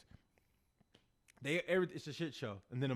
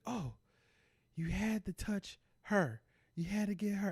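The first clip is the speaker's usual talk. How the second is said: mock outraged mom, pitched up and drawn out; retold freak-out; 5 semitones higher, slower than usual. Mom accusing someone over her daughter, mock freak-out. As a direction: act out the mom freaking out, high and slow. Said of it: "starts freaking out".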